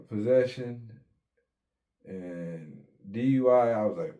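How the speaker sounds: usual speaking voice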